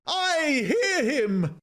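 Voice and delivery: very high, slow outcry; drawn-out cry. A narrator's reading. Woman springing to her feet at the approaching being, long high cry. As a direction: cry out high, stretching every word. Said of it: "she cried".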